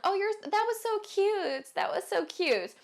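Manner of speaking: high, drawn-out fake sweet praise; catty mock praise. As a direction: coo it like a catty rival. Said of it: "They're like".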